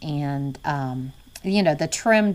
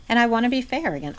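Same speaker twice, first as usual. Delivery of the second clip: ordinary delivery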